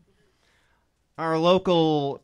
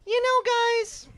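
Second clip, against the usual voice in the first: mock announcer voice. Comedian mock earnest opener.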